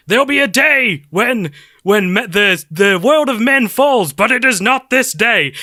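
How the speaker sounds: hammed-up Aragorn speech; mock heroic speech. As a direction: parody an epic rallying king. Imitating Aragorn's rousing speech.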